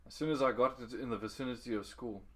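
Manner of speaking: usual speaking voice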